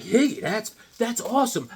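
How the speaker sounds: lightly voiced delighted kid; mock excited reader. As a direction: gush like an impressed fan. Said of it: "you're like".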